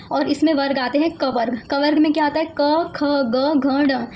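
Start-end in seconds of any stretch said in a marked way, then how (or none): 2.6-4.1 each letter spaced out very slowly; slow dictation. Shown as a demonstration. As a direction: spell the letters out one by one for students to copy. Teacher dictating the letter list. (बहुत धीरे, एक-एक अक्षर रुककर)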